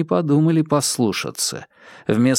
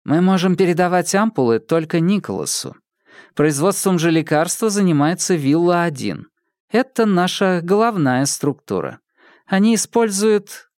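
woman character voice; 6 semitones higher than usual. Acted. Dylda explains protocol.

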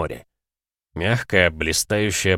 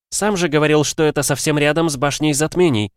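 higher, lighter woman's voice; female character voice; 8 semitones higher, 4 dB louder than usual. Acted.

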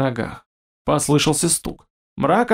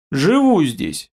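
grumble; slower than usual. A narrator's reading. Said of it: "буркнул мрак".

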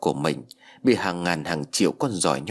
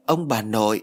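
ordinary delivery, nothing marked

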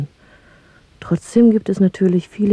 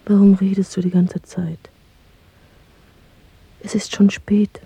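urgent little whisper; impatient lover's whisper. Acted. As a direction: hush him, it's late. Secret lovers, time running out.

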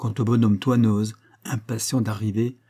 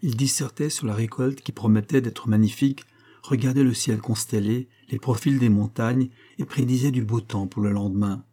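plain reading voice

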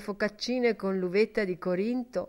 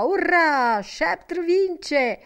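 high, loud cry of victory; excited cry from the stands; cheering shout; 9 semitones higher, 3 dB louder, much slower than usual. A narrator's reading. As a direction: cheer her horse home, higher and louder. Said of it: "Agitava la sciarpa e gridava".

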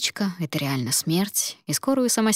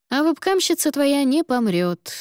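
put-on teasing older colleague; 8 semitones higher, 3 dB louder than usual.

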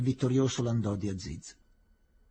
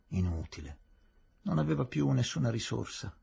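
ordinary delivery

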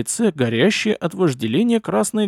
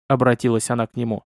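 usual speaking voice

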